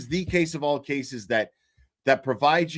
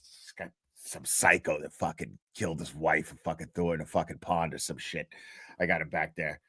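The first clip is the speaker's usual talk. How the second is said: much lower, offhand tough-guy voice; lower, careless defence lawyer shrugging off his client; a lawyer in court, casually shrugging off his client, in a much lower voice; gruff lawyer voice; 10 semitones lower, 7 dB quieter than usual. Retold or imitated. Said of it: "he was like".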